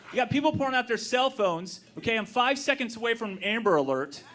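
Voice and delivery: usual speaking voice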